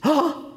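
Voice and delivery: sudden high shriek of recognition; a young lady in the elevator shrieks with excitement; mock shriek. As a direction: squeal like a starstruck fan. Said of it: "she shrieks".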